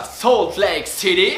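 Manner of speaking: drawn-out American accent on the city name; show-off American accent. Shown as a demonstration. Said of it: "T'as vu mon accent?". (accent américain frimeur)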